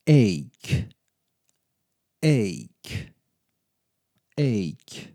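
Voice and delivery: "Ache" said as "ake", rhyming with make, with a hard k at the end; pronunciation model. Shown as a demonstration. Teacher models "Ache" as "ake" with a hard k for the class. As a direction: say "Ache" like "ake", ending on a crisp k. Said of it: "How do you pronounce this word?".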